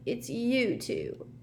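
put-on slow, high, glum ghost girl. A narrator's reading. Myrtle to Ron and Harry, glum. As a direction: drag it out in disappointment. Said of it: "she said glumly".

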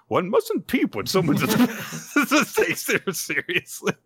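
high teacherly voice cracking up; imitated scolding dissolving into laughter; laughing mock reproof. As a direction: start a prim correction and lose it laughing. Retold teacher's correction, breaking into laughter.

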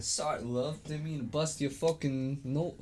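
mock-apologetic British accent; comic British apology; proper British boy. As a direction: apologise like a British lad, hammed-up. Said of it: "proper British boy".

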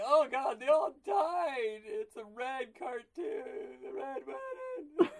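very high, loud, slow anguished shout; mock horrified shout. Kids watching a sad cartoon, hammed-up horrified shout. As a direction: wail in hammed-up horror. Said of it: "Yeah, it's like".

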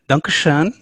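"danke schön" tried in a plain English-speaking voice, rising at the end; a light go at "danke schön" with a hint of German, lifting on schön; poor German accent. Shown as a demonstration. Host thanks German colleague with a weak German accent. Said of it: "my poor German accent".

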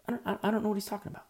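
high, hurried stammer of confusion; baffled bystander. Acted. Bystander answering his companion about a stranger's taunt, baffled.

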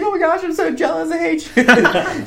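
high, mock-tearful jealousy; imitated crying wife. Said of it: "she starts crying".

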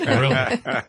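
laughing, high and surprised; a startled laughing question. Real, not acted. Laughing at smoking sage.

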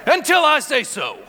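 booming pro wrestler growl; the Rock impression. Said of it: "That's my best rock impression".

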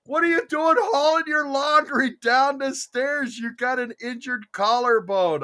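high, startled what are you doing; shocked disbelief. Retold or imitated. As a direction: react high-pitched in disbelief. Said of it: "What happened, Kat?".

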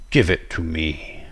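low, menacing demand; robed villain. A narrator's reading. Robed figure with a knife to the narrator, menacing villain voice. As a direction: voice a dark robed intruder. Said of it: "The figure spoke".

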